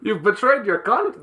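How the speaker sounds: high, hammed-up Russian accent; badly faked Russian accent. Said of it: "Can you do it in a Russian accent".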